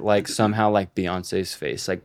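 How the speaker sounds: ordinary talk, nothing marked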